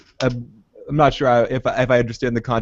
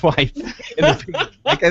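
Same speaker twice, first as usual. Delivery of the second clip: usual speaking voice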